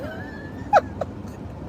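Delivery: a sudden high shriek of a laugh that bursts out; a real high-pitched laughing squeal. Real, not acted. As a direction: let out an unplanned squealing laugh. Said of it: "I don't know where that came from".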